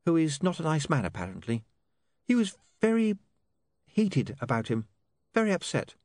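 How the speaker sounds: high, hesitant, mildly shaken delivery; taken aback. A narrator's reading. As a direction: pick the words haltingly, still startled. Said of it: "still taken aback".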